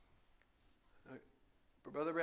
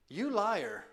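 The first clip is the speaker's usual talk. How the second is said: sharp accusing jab, a little higher and louder; mock accusation; 4 semitones higher, 4 dB louder than usual. Preacher confronting the chatbot, mock accusing. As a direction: call the chatbot a liar to its face. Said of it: "I kinda went after him".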